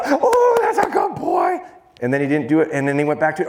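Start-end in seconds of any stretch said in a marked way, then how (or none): 0.0-1.6 very high, gushing praise; little girl voice. Shown as a demonstration. Trainer praising Tito for getting it, little girl voice. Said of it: "I sound like a little girl".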